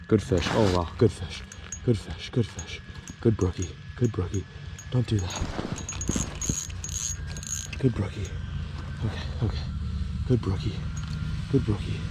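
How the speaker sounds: soft hushed coaxing; fishing whisper. Real, not acted. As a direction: coax the fish softly. Soothing fish in hushed voice.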